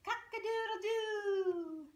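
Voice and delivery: high, slow cock-a-doodle-doo; rooster crow. Pretend. Rooster sound for toddler.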